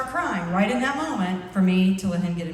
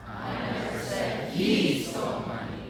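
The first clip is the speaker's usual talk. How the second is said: he punched out in a slow line; stress on he; 11 semitones lower, slower than usual. Shown as a demonstration. Group practicing one sentence together, stress on he. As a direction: hit the word he hard.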